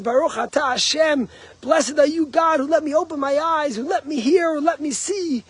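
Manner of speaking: high, loud, delighted thanks; glowing praise of God's gifts; joyful gratitude. Retold or imitated. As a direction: overflow with grateful joy. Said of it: "if you do everything with joy".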